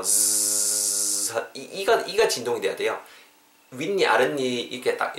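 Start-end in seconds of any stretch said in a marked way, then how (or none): 0.0-1.3 "즈" held long as a buzzing zzz; sustained voiced z on "즈", all vibration; vibrating z. Shown as a demonstration. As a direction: let "즈" buzz steadily like English z. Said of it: "입술이 떨려야 돼요".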